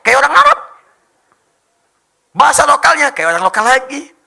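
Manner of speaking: high, very loud boast; rousing shout. Real, not acted. Preacher boasting of Indonesians' language gift, loud high shout. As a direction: belt it out to the audience. Rousing crowd about national talent.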